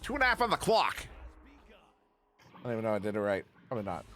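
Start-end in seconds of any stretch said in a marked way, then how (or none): 0.0-1.1 higher, hammed-up Pittsburgh accent. Shown as a demonstration. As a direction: lay on a thick Pittsburgh accent for the call. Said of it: "Do that with a Pittsburgh accent".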